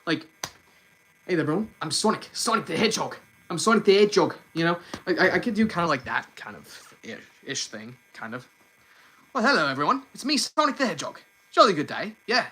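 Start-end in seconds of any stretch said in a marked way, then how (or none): 1.3-4.3 cartoon hero with a Brooklyn accent; attempted Brooklyn accent, breezy introduction; Sonic. Shown as a demonstration. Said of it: "I can do, like, a Brooklyn accent". 9.3-12.4 cartoon hero with a British accent; higher, jolly mock-British accent; Sonic. Shown as a demonstration. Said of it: "depending on the accent that I do".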